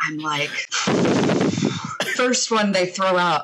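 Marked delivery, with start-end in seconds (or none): 0.6-2.1 long, noisy breath out; big acted sigh of dread; long exhale. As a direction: blow out a daunted sigh. Retells reaction to the challenge.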